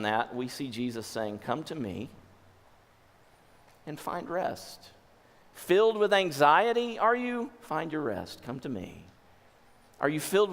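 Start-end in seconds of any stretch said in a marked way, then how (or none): 1.4-4.9 soft, slow comfort; gentle invitation. Acted. Voicing Jesus's invitation. 7.6-9.0 soft answer, dropping low; gentle invitation. Acted. Jesus answering the anxious.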